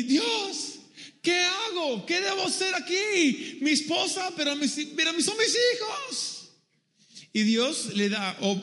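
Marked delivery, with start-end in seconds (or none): 0.0-6.3 high-pitched desperate cry to God; a hammed-up panicked wail, rising at the end; panicked pleading. Abraham in panic, pleading with the Lord.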